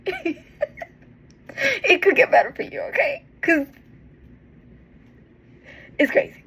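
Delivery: real tearful laugh of hope; laughing through tears. Real, not acted. Laughing through tears while encouraging viewers.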